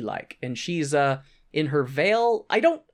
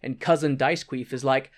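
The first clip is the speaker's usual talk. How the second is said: ordinary talk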